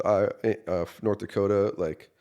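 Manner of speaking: ordinary talk, nothing marked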